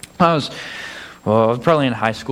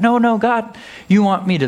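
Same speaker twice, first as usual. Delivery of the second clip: panicky protest; 7 semitones higher than usual. Pretend.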